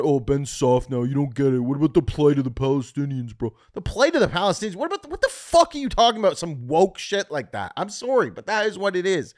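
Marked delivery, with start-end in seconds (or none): 0.0-3.5 mock woke critic. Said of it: "people want". 3.7-6.4 fast, high, loud disbelief; incredulous outburst. Real, not acted. Comedian scoffing at his critics, loud fast outrage.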